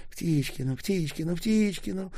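high, climbing, anxious repeats; trembling plea. A narrator's reading. Киселёк shaking as the slug approaches, pleading. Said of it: "затрясся Киселёк".

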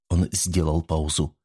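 ordinary delivery

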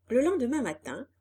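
plain reading voice

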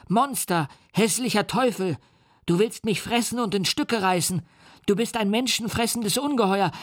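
put-on high, terrified little boy's voice; screaming child. A narrator's reading. The boy shrieks insults at the monster, high and frightened. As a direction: cry out in a child's panic. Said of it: "schrie er".

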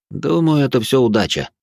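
ordinary delivery

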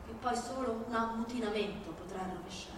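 ordinary talk, nothing marked

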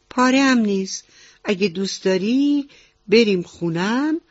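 lilting frog auntie with swooping pitch; helpful frog auntie. Acted. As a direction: offer help as a children's-story frog.

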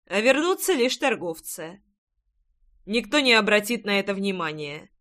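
ordinary delivery, nothing marked

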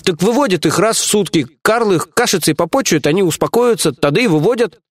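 high-pitched, placating henchman voice; explaining underling. Acted. Lieutenant explains slave care to his boss, obliging.

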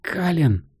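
high call from a distance. A narrator's reading. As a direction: call his name, leading him on. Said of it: "раздался голос девушки". (зов издалека)

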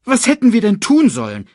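high, loud hyena protest; Banzai. A narrator's reading. Hyena defending himself to the boss, high and loud. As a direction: squawk back at the boss. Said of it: "fragte Banzai".

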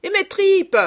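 loud, high booming call; stentorian cry. A narrator's reading. Boy bellowing after the waiter on the stairs. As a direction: boom it down the stairs. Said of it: "crie d'une voix de stentor".

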